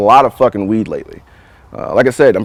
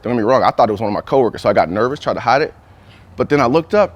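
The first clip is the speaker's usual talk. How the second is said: usual speaking voice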